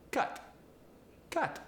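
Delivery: "cut. Cut." both as kaht; high Polish-accented "cut" with ah; Polish ah sound. Shown as a demonstration. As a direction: open "cut" to an ah vowel. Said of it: "both get the ah sound".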